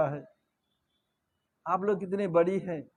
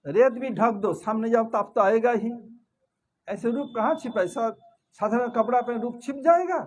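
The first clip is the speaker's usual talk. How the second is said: ordinary delivery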